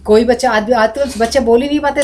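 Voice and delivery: usual speaking voice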